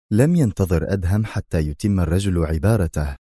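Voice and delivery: ordinary delivery, nothing marked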